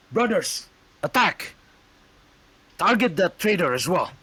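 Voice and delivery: high loud war cry; rally the troops to charge; battle command. Acted. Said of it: "And, like".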